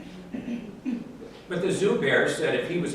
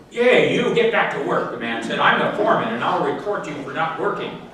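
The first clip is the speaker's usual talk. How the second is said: louder, higher bossy shout; barking foreman; 4 semitones higher, 6 dB louder than usual. Acted. Foreman yelling at the bear to get back to work, louder. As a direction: bark the order like a boss.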